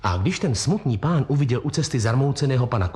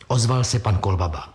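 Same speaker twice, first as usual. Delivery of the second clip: plain reading voice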